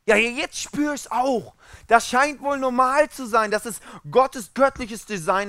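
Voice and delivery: high, hammed-up complaint; mock pained realisation. Acted sufferer suddenly feeling the pain. (gespielt schmerzgeplagte, hohe Stimme)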